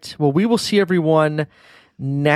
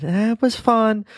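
imitating daughter; 9 semitones higher, slower than usual. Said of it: "I don't think you said".

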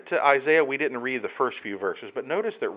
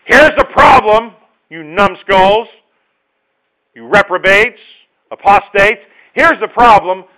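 loud, high, slow scolding; stern rebuke; 9 semitones higher, 18 dB louder, slower than usual. Pretend. Preacher voicing God sternly rebuking apostates, loud slow scolding. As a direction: lash them with name-calling. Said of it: "God rebukes sternly and then says".

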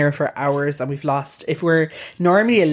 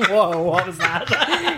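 normal talking voice

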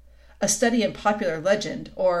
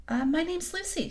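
slightly hesitant, higher-pitched reply; a touch of confusion, pitched up; mildly bewildered; 8 semitones higher than usual. A narrator's reading. Lucy answers the fawn's odd question, mildly bewildered. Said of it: "not quite understanding him".

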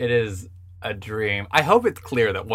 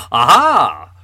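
Sherlock exclaiming; 2 semitones higher, 11 dB louder than usual. Retold or imitated. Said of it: "he would go".

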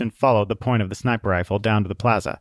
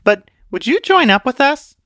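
put-on much higher, bright-eyed girl voice; sniper girl; 6 dB louder than usual. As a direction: pitch it up like an eager recruiter girl.

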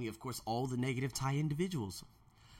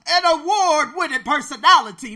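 much higher, louder playful brag; mock boast; 13 semitones higher, 16 dB louder than usual. Real, not acted. Jokes about his own award-winning personality, loud high brag. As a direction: crow about yourself in jest.

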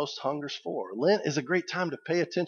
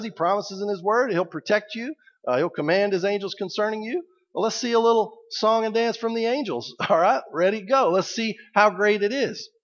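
higher, mocking devil egging Jesus on; taunting devil; 6 semitones higher than usual. Preacher voicing the devil daring Jesus to jump, mocking taunt. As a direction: goad him like a sneering tempter daring a stunt. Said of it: "this is where the devil is saying".

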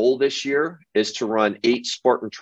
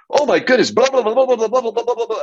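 excited gushing; 13 semitones higher, 3 dB louder, faster than usual. Retold or imitated. Starstruck gal gushing at Paul.

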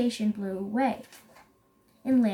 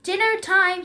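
raised, loud predator's announcement; mild growl; 7 semitones higher, 6 dB louder than usual. A narrator's reading. Lion pounces on his supposed guest, mild growl. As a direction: growl it lightly, storybook style. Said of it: "he growled".

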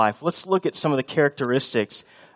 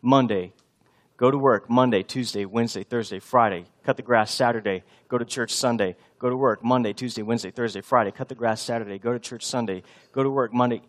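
droning list in a lowered voice; monotonous routine; 4 semitones lower than usual. Acted. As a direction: recite the week like an endless, tiring cycle. Acting out endless boring weekly cycle.

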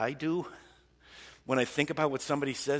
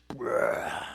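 drawn-out "bleurgh"; imitated spew of emotion; mock puking noise. Preacher picturing emotions pouring out in prayer, mock puking. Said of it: "puke of all these emotions".

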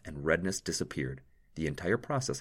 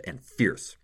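usual speaking voice

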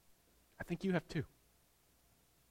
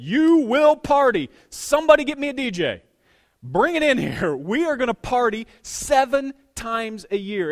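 God's booming voice; 6 semitones higher, 9 dB louder than usual. Acted. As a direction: boom it out like God throwing a party. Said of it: "And God said".

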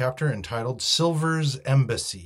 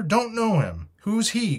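much higher dismissive cry; 11 semitones higher, 5 dB louder than usual. A narrator's reading. Captain refuses to recognise Silver as captain, high cry. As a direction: toss it back in a high voice. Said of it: "cried the captain".

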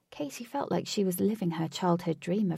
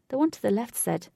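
ordinary delivery, nothing marked

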